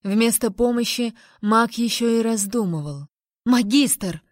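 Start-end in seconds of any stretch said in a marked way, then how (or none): 3.4-4.2 brief, forceful exclamation; barked call. A narrator's reading. Heroine demands help from the mage, barked call. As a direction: bark the title with mild anger. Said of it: "рявкнула я".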